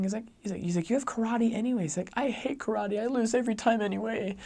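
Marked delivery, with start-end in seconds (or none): 0.9-1.8 high, mock-exasperated argument; retold protest. Dad reminds son about karate, retold protest. 2.1-4.4 put-on high, whiny child voice; mock a little kid's tearful complaint; imitated crying boy. Little son protests to dad about karate, imitated tearful whine. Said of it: "he starts crying".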